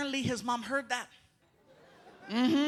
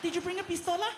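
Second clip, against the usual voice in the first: mock novela lady; 9 semitones higher, faster than usual.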